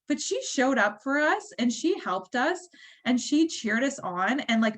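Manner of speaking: ordinary delivery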